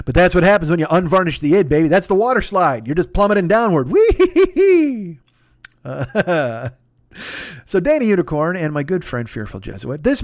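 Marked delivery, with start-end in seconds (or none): 3.9-5.2 high, loud, long-held "wheee"; mock gleeful whee. Podcaster plummeting down the water slide of his id, gleeful squeal. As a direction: squeal it like a kid sliding down.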